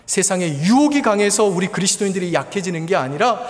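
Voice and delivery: high, loud preaching build; fiery preaching. Acted. A pastor urging his congregation, loud fervent preaching. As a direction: preach it out with full conviction.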